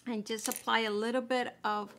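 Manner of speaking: normal talking voice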